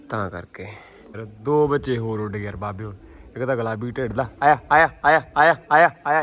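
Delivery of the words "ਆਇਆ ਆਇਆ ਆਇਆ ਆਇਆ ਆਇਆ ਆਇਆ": calling out. Real, not acted. Calling pigeons to land.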